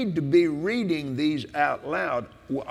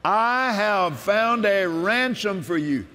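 preaching with force; 4 semitones higher than usual. Acted. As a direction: declare it like a sermon. Proclaims scripture.

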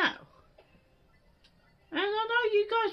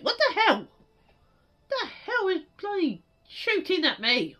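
ordinary talk, nothing marked

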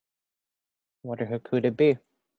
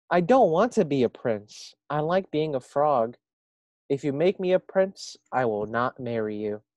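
bossy frog; 4 semitones higher, 7 dB louder than usual. Acted. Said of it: "Frog croaked in his bossiest voice".

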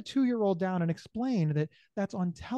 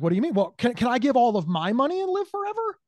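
manic excitement; 5 semitones higher, 7 dB louder than usual. Retold or imitated. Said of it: "I'd be like".

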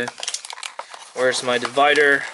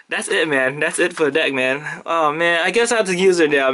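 ordinary delivery

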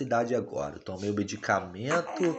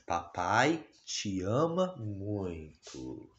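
tender; 3 semitones lower, 6 dB quieter, much slower than usual. Real, not acted. Dad tells baby he loves her.

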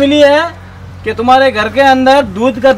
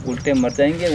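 much softer, lower worried aside; hushed worry; 11 semitones lower, 10 dB quieter than usual. Acted. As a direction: fret quietly to colleagues. Officer whispers worry aside. (धीमी, नीची आवाज़ में चिंता)

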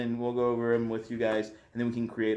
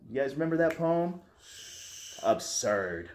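ordinary talk

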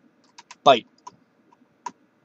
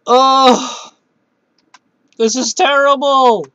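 real higher, louder wail of dismay; dismayed cry; 11 semitones higher, 7 dB louder, slower than usual. Real, not acted. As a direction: cry out in frustration. Battle going badly.

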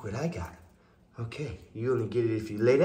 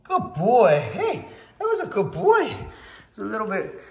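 much higher, laughing praise; squeaky praising a dog; 11 semitones higher, 6 dB louder than usual. Real, not acted. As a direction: gush over the dog, squeaky. Praising the dog after success.

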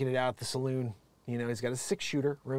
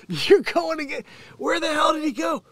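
much higher, louder hammed-up yell; mock shout; 12 semitones higher, 6 dB louder than usual. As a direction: bellow like a kid looking for his dad. Mock shouting abandoned kid.

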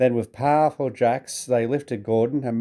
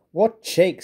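higher, indignant splutter; 8 semitones higher, 3 dB louder than usual. A narrator's reading. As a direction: sputter it out, offended. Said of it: "spluttered Gordon".

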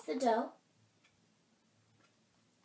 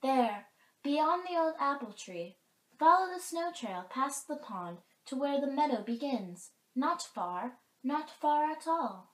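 lightly voiced dove, higher and louder; mourning dove; 7 semitones higher, 8 dB louder than usual. A narrator's reading. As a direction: point the way as an eager bird. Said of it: "asked the mourning dove".

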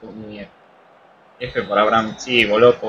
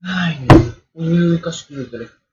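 sudden higher, louder gasp of alarm; startled; 5 semitones higher, 9 dB louder than usual. Real, not acted. Gamer nearly dropping his phone, startled and louder. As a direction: jolt as if you nearly dropped something.

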